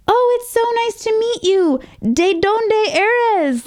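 very high-pitched, drawn-out gushing greeting; sing-song high politeness, labouring over limited Spanish; overly nice friends. Retold or imitated. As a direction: imitate friends trying really hard to be nice. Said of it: "my friends were all nice and they were like".

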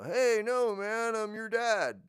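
high, slow put-on voice; mimicking animal. Mimic animal fooling a predator, mock dad voice. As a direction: mimic a father, hammed up.

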